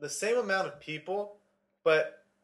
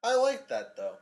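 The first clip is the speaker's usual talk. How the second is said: high-pitched mock approval; sarcastic; 9 semitones higher than usual. Co-host teasing about marathons in week one, sarcastic. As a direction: put on a high, insincere liking. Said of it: "That's sarcasm".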